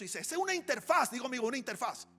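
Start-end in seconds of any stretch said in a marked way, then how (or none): 0.2-1.1 high, loud, emphatic reveal; proud announcement. Retold or imitated. Friend proudly revealing the interface, loud and high. 1.3-2.1 higher, louder marvelling repeat of "una interfaz"; awed excited echo. Retold or imitated. Pastor marvelling aloud at the word, excited.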